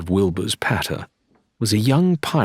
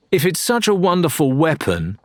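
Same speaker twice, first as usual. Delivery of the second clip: challenging; 8 semitones higher than usual. Acted. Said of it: "Lorena challenged".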